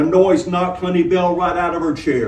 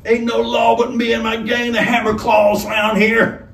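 higher, loud gang boast; shouting robber; 9 semitones higher, 4 dB louder than usual. Acted. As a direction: bellow it to scare the room. Robber shouts down Straw Hat.

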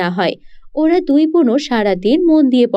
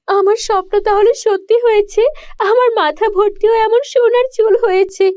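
put-on much higher, thrilled young girl; can't believe the dream came true; girl delighted her dream came true, high and excited; overjoyed girl; 10 semitones higher than usual. Girl's dream comes true.

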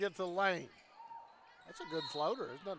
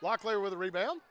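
building play-by-play, louder on the score; live play-by-play; 11 semitones higher, 6 dB louder than usual. Real, not acted. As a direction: call the passes and lift into the basket. Live call of a scoring play.

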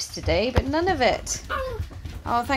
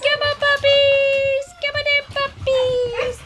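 high sing-song call, drawn out; calling the puppies; 12 semitones higher than usual. Real, not acted. Trainer coaxing her puppies into the office, high sing-song call. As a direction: coax the puppies in, high and bright.

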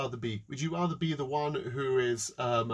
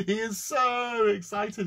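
gleeful; 6 semitones higher, slower than usual. A narrator's reading. Narrating his glee.

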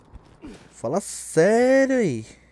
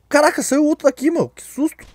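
startled; 10 semitones higher, 5 dB louder than usual. Real, not acted. Monster pops out suddenly.

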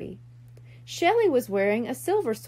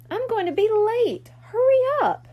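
rising to an anxious, loud hurry up; urgent call; 10 semitones higher, 5 dB louder than usual. A narrator's reading. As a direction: push him along, getting louder and higher. Late, urging her brother.

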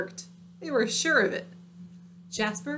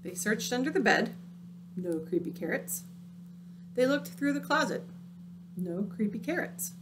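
usual speaking voice

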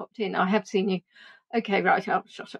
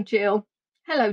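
ordinary talk, nothing marked

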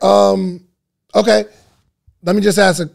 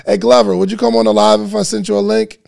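calling out; 3 semitones higher, 6 dB louder, faster than usual. Real, not acted. Calling a viewer onto the live.

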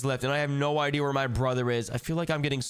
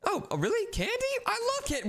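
high, delighted squeal over candy; excited child voice; much faster than usual. Acted. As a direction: get thrilled over candy. Tricked with candy.

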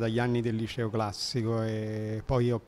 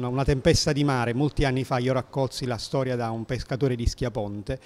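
ordinary delivery

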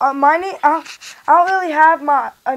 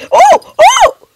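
very high, louder squeal; 7 dB louder than usual. Pretend. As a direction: squeal in delight. Toy squeals at the dance.

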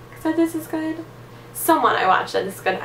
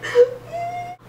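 teary gasp. Real, not acted. Overjoyed by the primer.

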